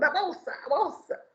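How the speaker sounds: imitating a robber's threat; mock robber demand. Preacher comparing the man to a robber demanding the purse. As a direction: bark it like a thief. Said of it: "ladrão falando".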